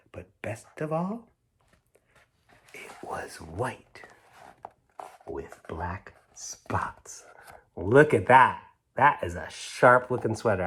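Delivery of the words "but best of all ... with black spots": slow, soft whisper building suspense; whispered reveal. A narrator's reading. Storyteller whispering the sweater's pattern to children, slow. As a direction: whisper the surprise ending.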